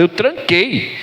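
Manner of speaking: usual speaking voice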